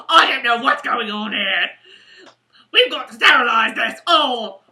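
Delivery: very loud British scolding; Sister Evangeline. Acted. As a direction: burst in scolding with a British accent. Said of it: "Sister Evangeline would walk in like".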